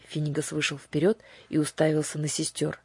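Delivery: ordinary delivery, nothing marked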